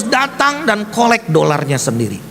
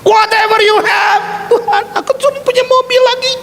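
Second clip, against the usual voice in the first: very high, shouted exchange with God; acted high outcry. Preacher replaying his exchange with God about the car, shouting. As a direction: cry it out at the top of the range. Retold dialogue with God, protest.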